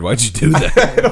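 high, loud and slow disbelief; incredulous. Real, not acted. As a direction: stretch out the disbelief. Baffled by friend's solo movie trip.

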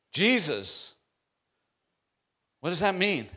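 high, loud, fervent proclamation of the angel's words; preaching at full force. Acted. Preacher proclaiming the angel's message to Mary, fervent full-force preaching. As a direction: lift it up and shout the good news like a revival preacher.

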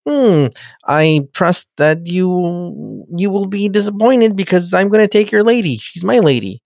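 slow, drawn-out mock alien villain; pushy Ferengi. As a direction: imitate a scheming Ferengi staking a claim. Acts out pushy Ferengi challenger.

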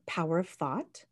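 normal talking voice

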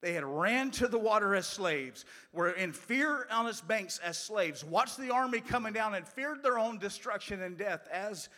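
loud, high sermon delivery; impassioned preaching. Real, not acted. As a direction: preach it at full force, driving the repeated phrase home.

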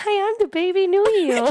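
put-on high squeaky baby talk. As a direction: chirp it like a tiny baby. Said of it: "I should actually sound like a baby".

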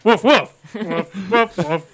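imitated dog barking; dog accent. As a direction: talk to a dog in woofs. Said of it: "adopted the accent of said animal".

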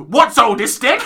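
imitating a grumpy British teddy bear come to life; grumpy British guy. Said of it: "he just sounds like this".

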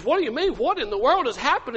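high, bewildered questioning; alarmed wife. Acted. Wife asking weeping Peter what happened, alarmed. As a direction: press him in high-pitched disbelief.

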